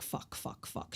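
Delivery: whispered swearing under the breath; hushed, voiceless imitation of panic; panicked whisper. Retelling her seeing the Davy mask, whispered panic.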